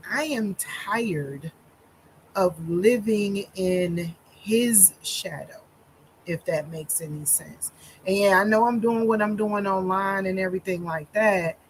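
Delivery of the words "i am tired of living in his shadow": very slow, every word set down carefully; slow to hold back tears. Real, not acted. Wife telling followers about her husband, slow and held back. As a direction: speak slowly so you don't break down. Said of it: "I'm gonna talk really slow".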